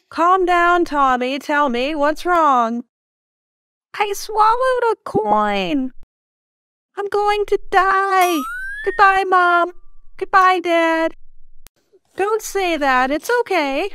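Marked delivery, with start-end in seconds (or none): 0.1-2.8 soothing parent. Acted. Parent soothing crying child. 3.9-11.1 high, wailing child voice, hammed-up despair; child's overblown tearful goodbye; melodramatic child farewell. Child thinks he will die.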